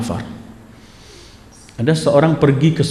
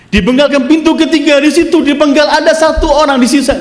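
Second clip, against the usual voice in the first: fervent story preaching; 10 semitones higher than usual. Acted. Preacher retelling the commander's oath to Harun, raised and fervent. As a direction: swear it with rising urgency.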